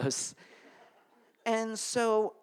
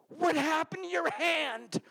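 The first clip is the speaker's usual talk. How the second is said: higher, slow, horrified mom voice; shocked mom; 11 semitones higher than usual. Retold or imitated. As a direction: gasp it like a horrified mother. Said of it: "she goes".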